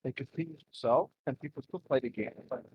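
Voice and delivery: muffled and distant over a bad mic; underwater mic. Real, not acted. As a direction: voice like it's coming through water. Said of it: "sounds like you're underwater".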